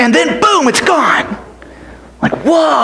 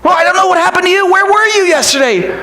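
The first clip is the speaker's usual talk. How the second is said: higher, fast, brushing-off reply; friend Benji, quick and high; 8 semitones higher, faster than usual. Acted. Friend Benji answering boy, quick. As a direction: rattle it off as the friend.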